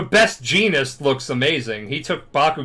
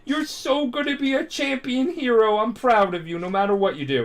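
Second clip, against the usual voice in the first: high, gushing mom voice; imitated high-pitched maternal delight; overjoyed proud mom; 11 semitones higher than usual. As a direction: gush like a mom jumping for joy. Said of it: "his mom is, like, jumping for joy".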